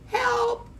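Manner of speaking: very high-pitched panicked cry; wailing for help. A narrator's reading. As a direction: squeal desperately for rescue. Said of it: "wailed the animals".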